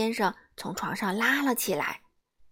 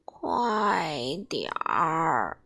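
very slow and lower, dragging; sleepy drawn-out echo; 5 semitones lower, much slower than usual. Acted. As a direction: say hurry as lazily as possible.

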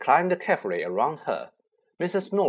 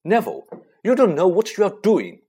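exasperated; 7 semitones higher, 7 dB louder than usual. Acted. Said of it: "in exasperation".

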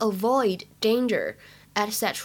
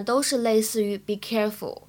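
normal talking voice

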